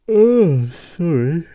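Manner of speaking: big loud yawn running into the words. Real, not acted. Podcaster reading out a listener's message, overtaken by a yawn. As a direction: apologise through a high, loud yawn. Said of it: "I just, just yawned".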